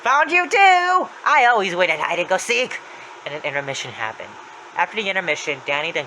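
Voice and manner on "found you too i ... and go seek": high, loud gloating call; Happy's voice. Retold or imitated. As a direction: crow over the find, starting very high. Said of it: "looks up at Danny and says".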